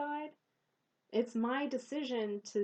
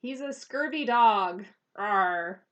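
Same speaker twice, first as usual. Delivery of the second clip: louder, hammed-up pirate snarl; 9 dB louder than usual. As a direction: play a cartoon pirate growling. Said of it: "I do need to work on my pirate accent".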